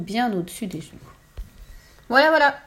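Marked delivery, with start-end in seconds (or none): 2.1-2.6 loud, high, pleased exclamation; acted self-satisfied cry. A narrator's reading. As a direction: announce it with satisfaction. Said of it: "dit-elle satisfaite".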